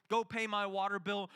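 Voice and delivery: ordinary talk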